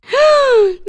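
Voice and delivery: high, loud shocked gasp; horrified gasp. Acted. Grandmother learns Layla missed that lesson, horrified gasp. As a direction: gasp in alarm.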